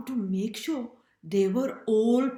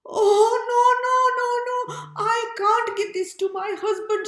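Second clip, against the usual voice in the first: put-on high, loud dismayed old woman; old woman in a hammed-up panic; horrified old woman; 13 semitones higher, 8 dB louder than usual. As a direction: gasp it out high and loud in horror. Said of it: "she said".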